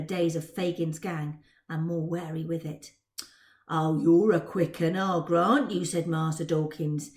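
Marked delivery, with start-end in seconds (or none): 3.7-5.8 put-on low, amused street boy; grudging praise with a grin; cheeky Cockney lad. Dodger voice.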